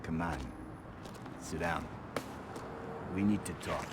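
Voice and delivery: soft, subdued invitation to talk; quiet, serious. Acted. Takes the boy aside to talk.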